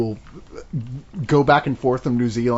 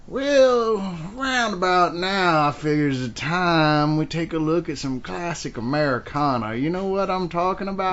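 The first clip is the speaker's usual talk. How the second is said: slow, softer cowboy drawl; old-time Western drawl; 2 semitones higher, 3 dB quieter than usual. Acted. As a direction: ease into a folksy Old West accent. Skit introducing classic Americana, western talk.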